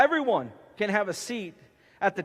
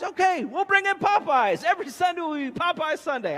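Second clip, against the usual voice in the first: much higher and louder, gleeful; higher, louder glee about Popeyes Sunday; excited promise; 9 semitones higher, 5 dB louder than usual. Acted. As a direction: sell it like the best news all week.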